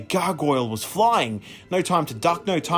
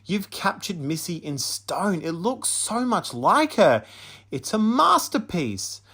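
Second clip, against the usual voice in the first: gushing praise; 6 semitones higher than usual. A narrator's reading. Teacher gushing over the statue.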